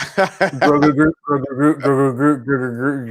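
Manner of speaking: Grogu Groot repeated, slurring into "Grogu Gut"; tongue twister attempt. Shown as a demonstration. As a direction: stumble through Grogu Groot fast. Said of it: "say Grogu Groot ten times fast".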